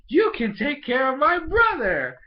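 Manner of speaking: high, loud imitation of an eager little sister; excited mock plea. Little sister volunteering someone for her brother, hammed-up excitement. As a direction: blurt it out loudly, high-pitched. Bonnie proposing, acted out.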